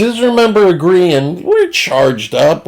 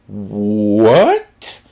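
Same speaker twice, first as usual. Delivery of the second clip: incredulous what; 5 semitones lower than usual. Retold or imitated. Shocked reaction.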